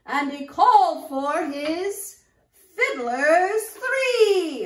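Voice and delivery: put-on loud, sing-song reading to kids; nursery rhyme recitation. Storyteller reciting Old King Cole to children, loud and sing-song. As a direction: recite it big for a room of children.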